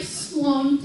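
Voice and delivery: ordinary delivery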